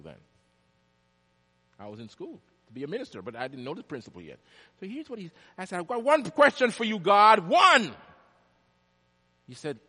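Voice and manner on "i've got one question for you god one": much louder and higher, demanding; loud challenge to God. Retold or imitated. Preacher retelling how he challenged God, loud and high. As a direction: call God out with one question.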